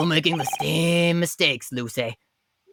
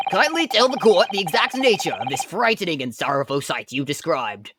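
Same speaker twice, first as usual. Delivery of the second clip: ordinary talk, nothing marked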